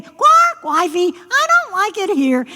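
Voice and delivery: high frog whine, loud first croak; high-pitched, peevish frog; complaining frog. Acted. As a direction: fuss like a frog afraid of missing comfort. Frog complains once more.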